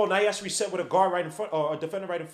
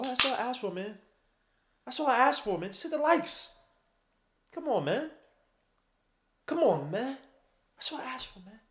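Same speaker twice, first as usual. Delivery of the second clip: pleading; 8 semitones higher than usual. Real, not acted. Begging viewers for likes.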